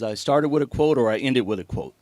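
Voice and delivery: normal talking voice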